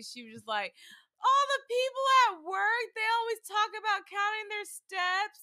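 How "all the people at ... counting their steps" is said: high, loud, gushing imitation; overjoyed mom. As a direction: imitate a mom thrilled with her gift. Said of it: "she was so happy".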